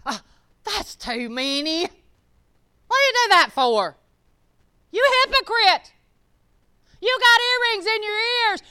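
hammed-up scolding gossip; mocking judgmental churchgoer. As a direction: sneer like a judgy church lady. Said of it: "people judged her".

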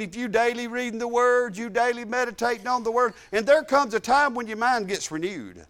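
ordinary delivery

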